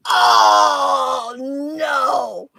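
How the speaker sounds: a loud, stretched oh no of horror; dismayed realization. Retold or imitated. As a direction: shout it as the mistake dawns on her. Realizing her math mistake in class.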